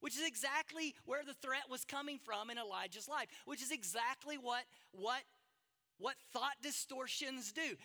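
loud, driving sermon cadence; pounding pulpit delivery; full-force preaching. Acted. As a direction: preach it hard to the congregation.

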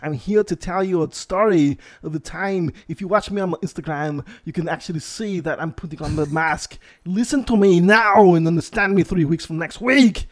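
Austrian-accented action star impression; Arnold Schwarzenegger. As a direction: do a thick Austrian strongman voice. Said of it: "I can speak, like, a little bit".

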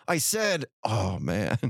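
mock insistent, dropping into a low sigh; pointed repeated sigh. Wife imagined repeating her sigh for attention, pointedly. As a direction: announce it, then groan the sigh out low. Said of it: "not gonna wait a couple minutes and go".

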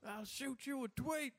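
high, slow menacing country dude; country serial killer voice. Pretend.

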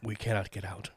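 soft, mock-ominous quote; ominous murmur. Ominous line, repeated louder later.

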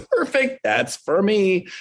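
high, bright "Perfect" then a slow, satisfied follow-up; mock delighted. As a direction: jump up in pitch like it's the best news ever. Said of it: "I go".

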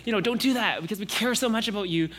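put-on caring relatives talking to a toddler; fussing parent. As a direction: coax the little one while fussing over her. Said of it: "everyone around her says".

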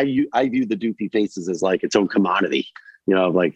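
ordinary talk

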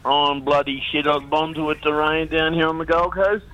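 ordinary talk, nothing marked

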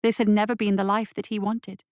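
plain reading voice

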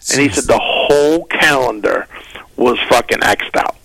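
ordinary delivery

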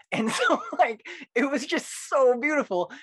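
high, loud, giggly rapture; gushing delight. Real, not acted. Poet recalling a beautiful night, gushing. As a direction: gush about it, voice lifted high.

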